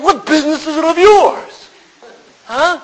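put-on high, loud, offended snap of a young man; indignant retort. Newcomer bristling at a churchgoer's question, a high loud indignant retort. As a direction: throw it back at him, affronted. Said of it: "And I got so mad at him".

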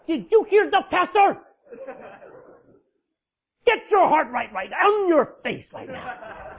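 loud, high, slowing shout of a scolding spouse; mock harsh shout. Spouse at home copying the pastor, shouting loud. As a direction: bark it like a spouse quoting the pastor. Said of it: "Do not talk like that".

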